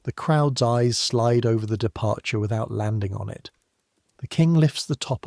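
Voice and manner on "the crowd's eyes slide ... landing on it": ordinary delivery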